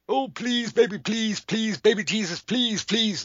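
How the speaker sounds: high, whiny hammed-up pleading; hammed-up whimpering plea; mock begging prayer. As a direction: beg baby Jesus like a desperate child. Mocking desperate prayer.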